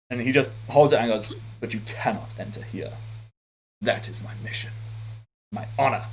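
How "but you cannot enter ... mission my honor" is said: low, quiet brooding anime samurai; grim and soft, an edgy anime swordsman; brooding samurai guarding a door, hushed. Acted. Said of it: "accented with the edgy anime".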